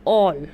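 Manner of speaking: all said loud with an open aw; model word. Shown as a demonstration. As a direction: punch the aw in all. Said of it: "third position".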